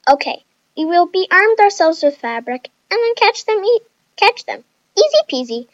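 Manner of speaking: high, perky, sweet delivery; sugary and eager, easing off at the end; quick and sweet. A narrator's reading. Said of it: "Chloe said quickly and sweetly".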